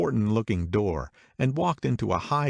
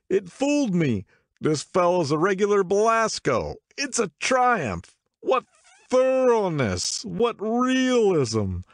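much higher, louder, slow, marvelling; excited drunk man; 12 semitones higher, 4 dB louder, slower than usual. A narrator's reading. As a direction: savour each word in tipsy awe. Drunk man praises the host.

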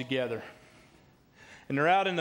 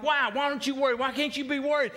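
high, exasperated mock demand; hammed-up frantic badgering; aggravated panic; 10 semitones higher, 4 dB louder than usual. Castaway kicking sand at his calm companion, high and aggravated. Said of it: "he just kicks the dirt".

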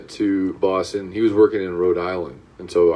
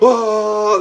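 long high "aah"; drawn-out, high-pitched mock scream; freaked-out yell; 11 semitones higher than usual. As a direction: imitate a crowd freaking out. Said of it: "they start freaking out".

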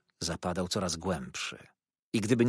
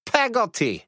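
"Peggotty" in a high, loud, indignant echo; indignant repeat; 14 semitones higher, 8 dB louder, slower than usual. A narrator's reading. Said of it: "powtórzyła z pewnym oburzeniem ciotka".

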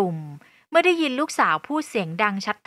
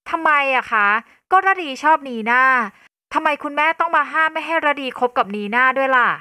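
high, raised-voice protest; displeased, getting loud; 5 semitones higher, 5 dB louder than usual. Acted. Daughter forbidden by her mother to date Nina, loudly displeased. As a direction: demand to know why, upset. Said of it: "เริ่มส่งเสียงดังอย่างไม่พอใจ".

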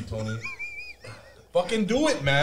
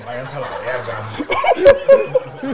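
laughing; 4 semitones lower, 9 dB louder, much slower than usual. Real, not acted. Laughing curse in Spanish.

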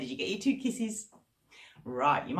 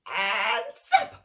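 sudden loud high snap; 14 semitones higher, 6 dB louder than usual. Acted. Crocodile snapping at a monkey, loud burst. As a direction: bite the word off suddenly.

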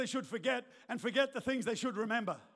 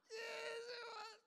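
tiny high drawn-out squeak; hammed-up tearful whimper; mock sobbing; 10 semitones higher, 15 dB quieter, much slower than usual. Believer confirms the year to God, slow mock-tearful whimper.

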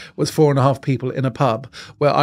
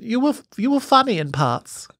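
lightly raised, put-on lady voice; a hint of the snooty stranger; posh woman; 7 semitones higher than usual. Same woman, backhanded compliment.

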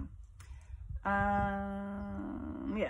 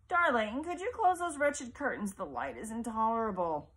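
a lightly voiced fretful mother, higher; 4 semitones higher, 3 dB louder than usual. Bedridden mother asking her son to shut the curtains, petulant. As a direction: plead petulantly about the light.

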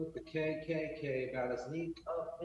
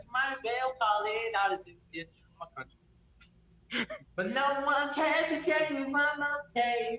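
higher, pushed mock hillbilly twang; 6 semitones higher than usual. Singing to a friend on stream, hillbilly twang. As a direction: belt it like a hammed-up hillbilly crooner. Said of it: "We're using a hillbilly voice".

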